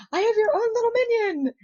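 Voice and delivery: high, sing-out announcement; excited gift presentation. Retold or imitated. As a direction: proudly present a toy in a high voice. Presents a plushie, retold.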